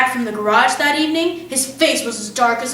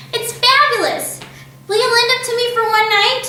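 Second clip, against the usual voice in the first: delighted; 10 semitones higher than usual. A narrator's reading. Matilda begging Fred to lend the parrot, high and delighted. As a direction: gush over the bird.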